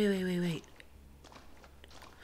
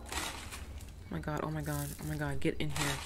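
hushed, frightened plea, much softer; quiet panicked urging to hide; scared hush; 4 semitones lower, 12 dB quieter, faster than usual. Real, not acted. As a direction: murmur it under your breath so he won't hear. Hiding from monster, frightened.

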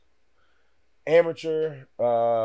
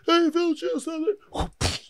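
high mock plea; much slower than usual. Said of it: "Bare fist".

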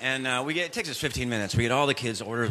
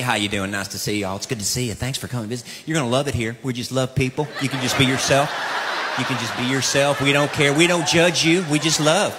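church greeter; 6 semitones lower, faster than usual. Retold or imitated.

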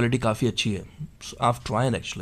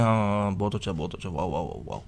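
soft, lower mock-impressed murmur of a lazy student; mock passive listener; 7 semitones lower, 10 dB quieter, much faster than usual. Teacher mocks a silent student nodding along, soft and low. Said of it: "ये नहीं कि आप चुप करके सुन रहे हैं".

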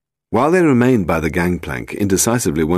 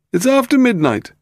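very high, loud protest; cried out; 5 dB louder than usual. A narrator's reading. Boat owner to men wanting aboard, high crying protest. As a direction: complain in a high-pitched cry. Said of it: "cried Bryce Pemberton".

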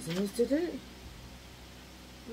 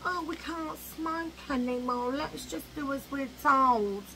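higher, slow, sheepish mock compliance; mocking meek voice; 5 semitones higher than usual. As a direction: mimic the obedient crowd, drawn out. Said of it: "we just go".

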